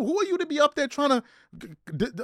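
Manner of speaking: ordinary talk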